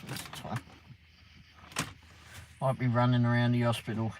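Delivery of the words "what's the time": a breathy whispered question. Real, not acted. Streamer whispers the time to himself late at night. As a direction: whisper it under your breath.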